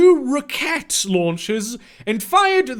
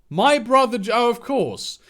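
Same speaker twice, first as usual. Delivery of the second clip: normal talking voice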